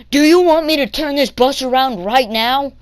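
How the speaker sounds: loud, high mock warning; imitated threat. Bus driver threatening kid mid-ride, loud imitated warning. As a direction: threaten loudly, as the fed-up driver.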